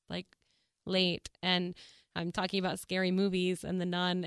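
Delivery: ordinary talk, nothing marked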